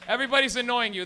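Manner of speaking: ordinary talk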